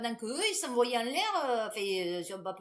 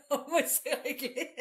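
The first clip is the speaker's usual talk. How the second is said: laughing; 3 semitones higher than usual. Real, not acted. Laughing conclusion.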